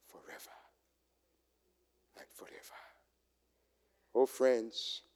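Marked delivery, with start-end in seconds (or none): 0.1-2.8 whispered praise. Acted. Heavenly chorus of praise quoted.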